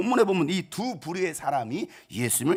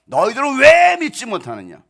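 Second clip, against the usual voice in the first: stern rebuke; 7 semitones higher, 10 dB louder than usual. Acted. Jesus rebuking his disciples, stern and loud. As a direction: thunder it as a reproach. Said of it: "책망하실 때는 왜 책망했습니까?".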